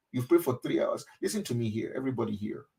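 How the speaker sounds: ordinary delivery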